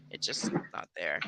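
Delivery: muttered. Real, not acted. Trailing lament.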